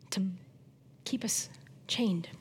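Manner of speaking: sudden dramatic whisper. Acted. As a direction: drop to a hushed whisper for effect. Preacher drops to a whisper.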